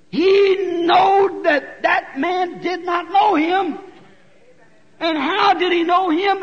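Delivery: a slow shouted sermon point; preaching shout. Real, not acted. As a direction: hammer each word home slowly. Preacher driving home a point.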